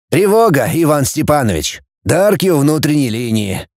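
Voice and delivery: usual speaking voice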